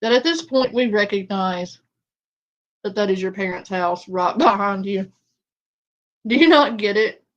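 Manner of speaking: loud, exasperated, half-laughing; exasperated yelling. Real, not acted. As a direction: yell in disbelief at her.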